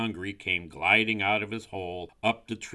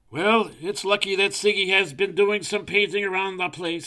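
high, loud storybook owl; owl character; 12 semitones higher, 9 dB louder than usual. A narrator's reading. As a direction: voice a scheming owl, pitched up. Narrator voices owl.

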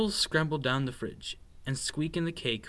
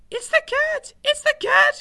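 very high, loud mouse shriek; panicked cry; 11 dB louder than usual. A narrator's reading. Scared mouse spots a stripy tail, shrieking in panic. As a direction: squeal the alarm at the top of your voice. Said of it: "he cried".

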